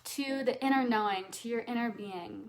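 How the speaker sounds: normal talking voice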